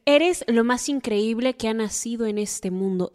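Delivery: ordinary delivery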